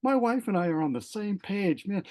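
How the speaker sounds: high, slowed, amazed exclamation; excited. Retold or imitated. Husband thrilled by his wife's news, speaking high and amazed. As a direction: let the excitement lift your voice right up. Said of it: "I'm getting excited thinking".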